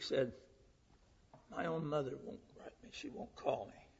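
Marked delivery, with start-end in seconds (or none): 1.5-3.9 tearful. Retold or imitated. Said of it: "with tears running down his cheek".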